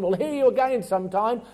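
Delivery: politely shelve it for later; brushing off. Retold or imitated. Preacher voicing Athenians putting Paul off, breezy dismissal.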